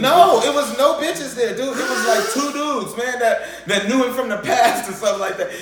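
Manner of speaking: high, loud, slowed with laughter; laughing excitedly; excited laughing storytelling. Real, not acted. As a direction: tell it cracking up.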